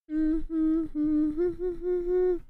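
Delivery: a long, high burst of real laughter. Real, not acted. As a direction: laugh it off at yourself. Laughing at her own pronunciation.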